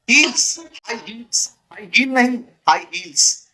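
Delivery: हाई heels spelled out slowly; slow drilling. Shown as a demonstration. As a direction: drill the words slowly to students. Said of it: "समझा क्या". (धीरे धीरे शब्द रटाते हुए)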